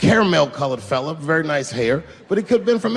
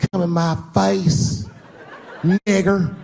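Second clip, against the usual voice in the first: put-on girlfriend's line; 3 semitones higher, slower than usual. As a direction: drag it out, teasing and slow. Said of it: "she looks up at me and goes".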